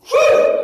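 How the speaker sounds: very high, loud "woo"; imitated excited whoop. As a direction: mimic a thrilled boy's cheer. Son's excited cheer retold.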